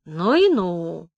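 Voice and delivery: slow, drawn-out amazement; wondering, long-drawn exclamation; marvelling admiration. A narrator's reading. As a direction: marvel at it, stretching the middle word. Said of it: "крутил от восхищения головой".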